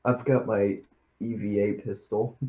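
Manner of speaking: ordinary delivery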